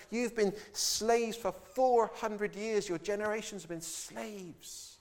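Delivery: ordinary talk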